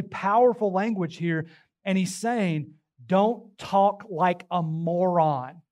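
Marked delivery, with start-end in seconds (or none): 3.1-5.6 very slow, word-by-word admonition; emphatic slow command. Retold or imitated. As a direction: drive the quoted command home slowly. Preacher driving home Paul's blunt command.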